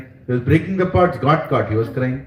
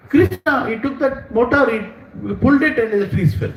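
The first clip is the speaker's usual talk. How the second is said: put-on high-pitched child witness; little children's voices; 10 semitones higher than usual. Gopa boys blame Krishna for the fallen trees, little children's voices. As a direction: blurt it out like an eager small child. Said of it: "small, small Gopa friends of Krishna".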